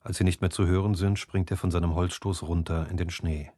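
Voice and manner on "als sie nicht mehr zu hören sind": ordinary delivery, nothing marked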